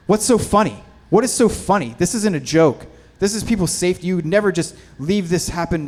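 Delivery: loud, high, indignant telling-off; retold angry scolding. Cinematographer to laughing lighting crew, retold indignant scolding. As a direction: imitate an irate boss berating laughing crew.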